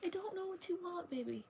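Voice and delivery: very soft, high pet talk falling away; cooing helplessly. Real, not acted. Soothing her meowing cat.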